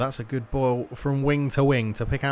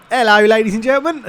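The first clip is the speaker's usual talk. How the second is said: hammed-up Cockney shout, much higher and louder; mock your own Cockney greeting, fast and belted out; exaggerated Cockney holler breaking into a laugh; 8 semitones higher, 11 dB louder, faster than usual. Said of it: "like, proper Cockney".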